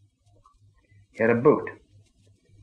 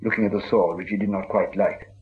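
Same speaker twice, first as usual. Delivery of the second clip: ordinary delivery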